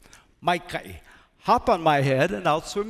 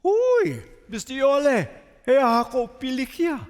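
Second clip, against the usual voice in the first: jolly octopus voice, much higher; octopus calling out; 6 semitones higher than usual. Acted. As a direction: call across the water as a cheery octopus. Octopus character calls to rat.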